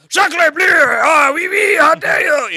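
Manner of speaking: loud, high mock French outrage shouting; french chef. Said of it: "there's some French".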